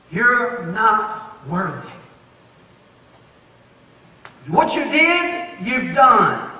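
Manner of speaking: slow, drawn-out accusation; the devil whispering. Acted. The devil whispering to a churchgoer, slow and accusing. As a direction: linger on each word to wound. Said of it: "the devil comes and whispers".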